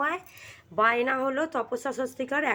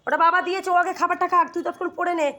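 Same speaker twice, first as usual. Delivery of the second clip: scolding; 7 semitones higher, 9 dB louder than usual. Real, not acted. Mother scolding her children.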